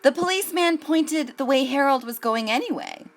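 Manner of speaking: put-on gentle read-aloud voice for children; unhurried picture-book narration; storybook reading. A narrator's reading.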